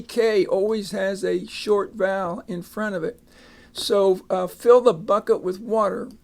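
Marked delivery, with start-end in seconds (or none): none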